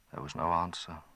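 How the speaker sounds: usual speaking voice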